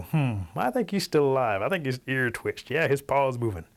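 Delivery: wary turkeys weighing the evidence; suspicious turkeys. Acted. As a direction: hum doubtfully, then insist on it. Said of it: "So they're all suspicious".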